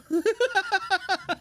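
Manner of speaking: high staccato giggle; rapid burst of high laughter. Real, not acted. Promising chat to let Zoran know, high giggling laugh.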